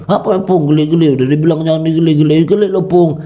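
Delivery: protes anak, nada tinggi dan cepat; marah yang sopan. Retold or imitated. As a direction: mengeluh kesal tapi sopan. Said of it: "marah lagi". (anak memprotes opung yang menggelitik, nada tinggi dan cepat)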